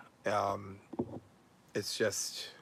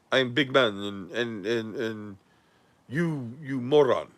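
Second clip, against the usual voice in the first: lightly voiced dopey tough guy; a hint of a dim brute talking big; mocking Nader; 4 dB quieter than usual. Host mimicking Nader's boasting, lightly mocking dumb voice. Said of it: "the stuff he says is so stupid".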